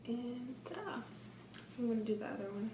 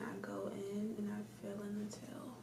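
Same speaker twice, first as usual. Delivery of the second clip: whispering; 5 dB quieter than usual. Real, not acted. Whispered while filling brows.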